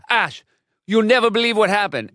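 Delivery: excited little brother. Retold or imitated. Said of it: "Axel goes".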